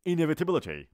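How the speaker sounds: Inevitability said briskly, high and loud; quick Inevitability with little space between syllables; still quick. Shown as a demonstration. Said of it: "بازم تند بود".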